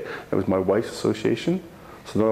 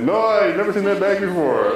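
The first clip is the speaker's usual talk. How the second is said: mock denial; 11 semitones higher, 5 dB louder than usual. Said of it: "I was like".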